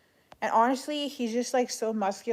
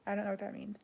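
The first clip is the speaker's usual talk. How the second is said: whispering; 8 dB quieter, faster than usual. Real, not acted. Aside while reading chat.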